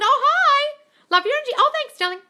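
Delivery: high, loud gushing thanks; sweet high greeting to a viewer; gushing delight. Real, not acted. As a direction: squeal a delighted hello to a fan. Gushing reply to live comments.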